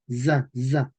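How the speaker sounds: za with a voiced English z; syllable drill. Shown as a demonstration. Teacher drilling the syllable, voiced pronunciation. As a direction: buzz za twice. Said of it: "decían casa".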